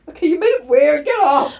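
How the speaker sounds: mock shout. Acting out shoving the man away.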